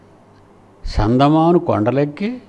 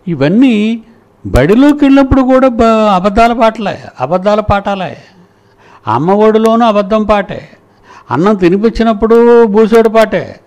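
much higher and louder, railing against the lies; impassioned outcry; 7 semitones higher, 5 dB louder than usual. Real, not acted. Angry rant about lies taught. (అబద్ధాలపై ఆవేశంగా, గట్టిగా)

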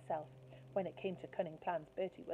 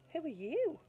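higher mock-innocent question; feigned blankness; 10 semitones higher, 5 dB louder than usual. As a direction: pretend not to know her at all. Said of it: "he asked".

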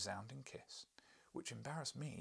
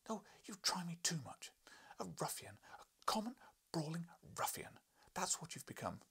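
high, exasperated scolding; a wife's high scolding; 8 semitones higher than usual. Acted. Wife to husband George, high exasperated scolding. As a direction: berate him in a high voice.

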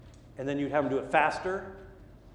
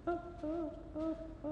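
put-on child whimper; 13 semitones higher, 10 dB quieter, faster than usual. Toddler whimpering before fix.

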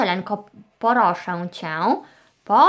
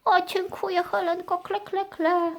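high, soft stork voice; grateful stork puppet; 8 semitones higher, 6 dB quieter than usual. Pretend. Stork thanks the girl, high soft puppet voice. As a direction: thank her in a small high bird voice.

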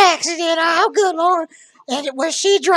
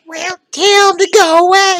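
loud, bossy brush-off; loud demand; 9 dB louder than usual. Acted. Old sweetheart telling caller to send visitors away, loud. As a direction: make him choose her, loudly.

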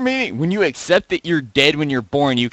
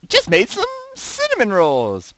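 much higher sing-song reading; mock reading voice; 6 dB louder than usual. Shown as a demonstration. Reading a fan's misspelled post, high mock voice. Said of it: "but cinnamon spelt completely wrong".

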